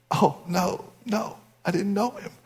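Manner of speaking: very soft, choked-back reply; hushed mock grief; grieving man. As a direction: answer weakly, acting bereft. Weeping man answers the pastor.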